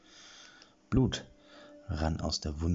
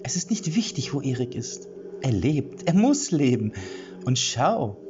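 higher witch voice rising to louder; insistent witch; 6 semitones higher, 3 dB louder than usual. Acted. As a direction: build from calm to fervent. Witch insists Erik must live. (Hexe, immer eindringlicher)

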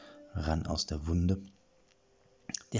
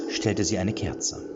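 plain reading voice

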